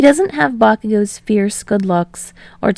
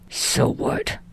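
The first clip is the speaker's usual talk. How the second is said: defiant; 8 semitones lower, 9 dB quieter than usual. A narrator's reading. Bakugo staring Uraraka down.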